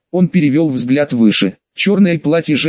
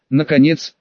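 ordinary delivery

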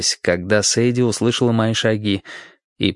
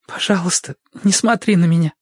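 pleading woman's voice; 8 semitones higher than usual. Acted. Wounded woman begs, high voiced.

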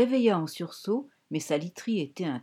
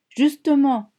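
Justement hammered out loud and high; 7 semitones higher, 8 dB louder, slower than usual. Acted. Sister in Fran's head cuts her off, hammering. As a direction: stamp the word down, much louder. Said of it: "martela la passagère clandestine".

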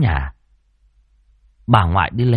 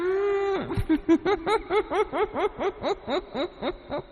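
long, high, soft ghost cackle; eerie high-pitched stuttering giggle; ghostly laugh; 10 dB quieter than usual. A narrator's reading. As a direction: laugh on and on like a spectre. Ghost laughs after threatening him.

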